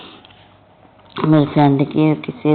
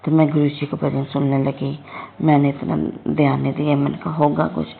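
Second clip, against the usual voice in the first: normal talking voice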